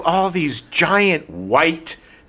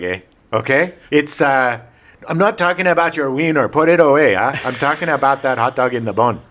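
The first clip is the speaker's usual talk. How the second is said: put-on Canadian accent. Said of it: "this is supposed to be in French".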